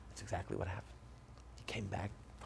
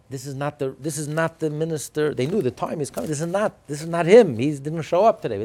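louder, higher urgent warning, retold; urgent hinting; 6 semitones higher, 12 dB louder than usual. Guard warning petitioners of the wrong man, urgent hinting. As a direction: imitate a guard pressing a hint, raised voice. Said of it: "guard was hinting to them".

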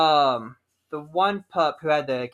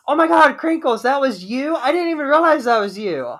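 loud, much higher gushing surprise; excited surprise; 9 semitones higher, 5 dB louder than usual. Retold or imitated. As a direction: burst out at spotting an old friend. Recognizing friend in face paint.

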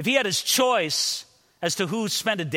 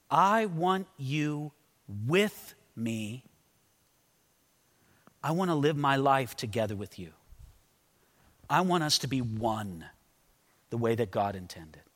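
tender; 4 semitones lower, 3 dB quieter than usual. Acted. Groom's tender words to maiden.

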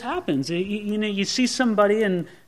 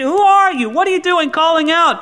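much higher and louder, demanding; startled shout; 8 semitones higher, 4 dB louder than usual. Acted. Just-woken Abner shouting back at David, startled and loud. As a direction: bark back at the voice in the dark.

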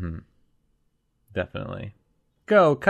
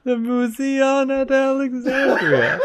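laughing; 10 semitones higher than usual. Real, not acted. Reading name while laughing.

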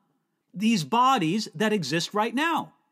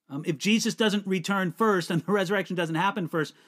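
usual speaking voice

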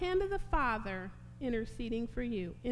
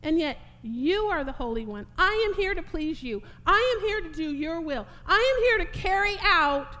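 high, loud cry of resolve to the Father; fervent, full-voiced surrender; impassioned Jesus; 7 semitones higher, 7 dB louder than usual. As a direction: plead with rising force, building to a shout. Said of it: "What's he saying there?".

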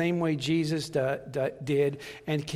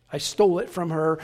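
normal talking voice

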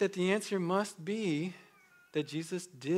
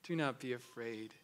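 gentle reassurance; 4 semitones lower, 6 dB quieter than usual. Acted. Soft repeat after loud.

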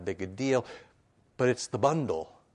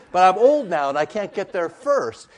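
loud, higher-pitched mock complaint; exasperated protest; 6 semitones higher, 11 dB louder than usual. Sick man complaining to Jesus, loudly exasperated. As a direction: plead your case at full, indignant volume.

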